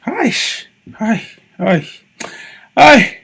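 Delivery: high squeaky mock greeting. Vlogger mocking an accented caller, high squeaky hi. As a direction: squeak it like a show-off. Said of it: "where they get accent".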